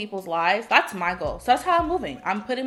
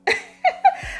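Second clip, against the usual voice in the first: airy squeal of a laugh; real amused cackle; high breathy laugh; 3 dB louder than usual. Real, not acted. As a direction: burst out giggling high. Laughing at the story.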